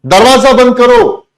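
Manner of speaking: shouted command, pitched high; loud order. Shown as a demonstration. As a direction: bark the order at the class. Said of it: "मैंने कहा". (ऊँची आवाज़ में आदेश)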